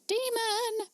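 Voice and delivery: sudden high yelp of a word; mock outburst. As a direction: blurt it out as a joke. Blurted joke mid-reading.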